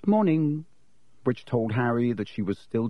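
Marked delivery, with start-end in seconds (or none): 0.1-0.6 a slight stiffness, coolly disapproving; a clipped, cool hello to friends she's annoyed with; stiff morning. A narrator's reading. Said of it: "a slight stiffness in the way she said".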